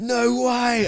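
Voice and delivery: loud, high shriek of disbelief; thrilled mock yell; amazed fan. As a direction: imitate a fan who can't believe it. Fan reacting to big news.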